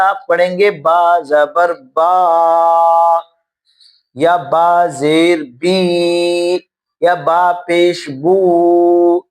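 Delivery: every syllable stretched long and slow; drawn-out syllables. Shown as a demonstration. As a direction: pull each sound out too long. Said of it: "یہ کھینچاؤ آ گیا اس کے اندر". (حروف کو کھینچ کر پڑھنا)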